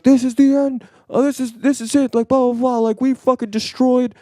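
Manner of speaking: high, loud hysterical doom-sayer; mock panic. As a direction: ham up the end-of-world panic. Mocking panicked net neutrality tweets.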